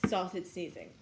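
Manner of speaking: normal talking voice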